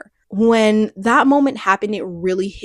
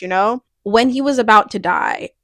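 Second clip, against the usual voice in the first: ordinary talk, nothing marked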